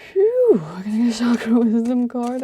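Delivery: breathless, laughing through it; puffing and gasping for air; out of breath. Real, not acted. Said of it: "I'm so out of breath with you guys".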